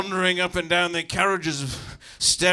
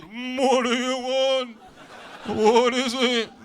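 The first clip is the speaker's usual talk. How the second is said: long, high, loud drawn-out bleated reply; responding parent sheep; 7 semitones higher, 6 dB louder, much slower than usual. Acted. Responding with paternal instinct to the lamb, high drawn-out call. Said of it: "paternal instinct to respond".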